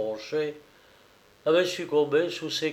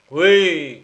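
a louder booming yes; cavernous yes; 3 semitones higher, 9 dB louder than usual. A narrator's reading. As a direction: boom it out like a strongman. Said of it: "d'une voix caverneuse".

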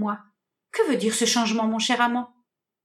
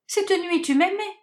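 pitched up in dismay; high distressed; 7 semitones higher than usual. Acted. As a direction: cry it out in disbelief. Hermia désemparée. (voix aiguë et désemparée)

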